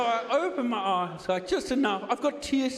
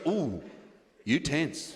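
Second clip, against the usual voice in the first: low, softer, clipped-English masseuse; masseuse's remark; 11 semitones lower, 3 dB quieter, much slower than usual. Retold or imitated. Comic imitating the masseuse commenting on his tense shoulders, low and soft. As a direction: croon it low like a knowing masseuse. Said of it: "She goes".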